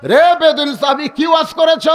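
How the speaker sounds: dramatic high-pitched sermon delivery; preaching. Acted. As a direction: call out the question from the pulpit. Preacher acts Ali calling at jail.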